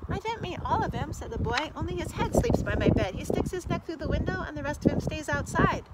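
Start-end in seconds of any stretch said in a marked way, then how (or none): 0.1-1.1 put-on high, earnest little boy; young boy voice. Boy explains to king. 1.7-5.8 put-on high, earnest little boy; young boy voice. Boy explains to king.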